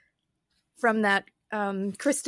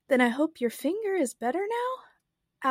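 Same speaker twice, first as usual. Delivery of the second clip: polite question in a higher pitch; 6 semitones higher than usual. A narrator's reading. Alice asks after the Queen's finger, very politely. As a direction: ask courteously, voice lifted. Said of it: "very politely".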